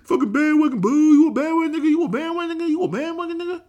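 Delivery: high, fast, heckling fan voice; squeaky taunting heckler; mocking upset fans. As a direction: needle him like an angry fan. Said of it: "upset people saying".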